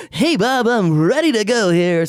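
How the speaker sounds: high, loud hyped announcer; fake announcer voice. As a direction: belt it like a ring announcer. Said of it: "I always like to do my fake announcer voice".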